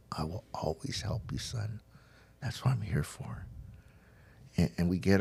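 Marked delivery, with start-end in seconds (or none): 0.1-3.4 very soft, sinking to a whisper; tender reassurance. Retold or imitated. Dad holding his son after he asks for help, tender hush. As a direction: comfort a child, barely above a whisper.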